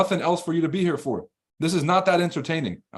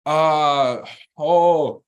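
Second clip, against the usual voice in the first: loud startled uh-oh; a sudden alarmed outburst; startled loud gasp; 6 dB louder than usual. Real, not acted. Realizing the near slip on stream: loud startled gasp. Near-miss on a banned word.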